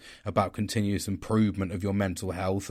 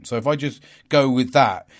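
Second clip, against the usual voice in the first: ordinary talk, nothing marked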